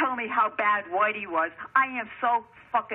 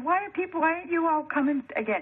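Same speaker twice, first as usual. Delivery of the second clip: mock polite question; 7 semitones higher than usual. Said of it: "I asked them very nicely".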